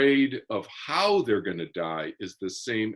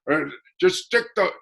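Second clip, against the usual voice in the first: put-on higher, louder Brooklyn-Boston tough-guy accent, cigar in the mouth; dad's Brooklyn accent; 6 semitones higher, 4 dB louder than usual. Son mimics his dad's famous saying in a Brooklyn-Boston accent. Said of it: "And he talked like this".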